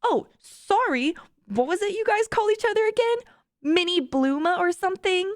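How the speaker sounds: high-pitched, mock-innocent teasing; teasing friend. A narrator's reading. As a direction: needle a friend with a fake apology. Caller teasing Fiona in read-aloud story.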